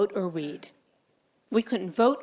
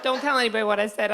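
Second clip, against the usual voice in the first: normal talking voice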